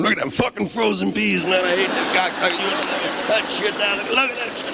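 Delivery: mock shoppers. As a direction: sneer at the frozen peas. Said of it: "you hear the people gather around".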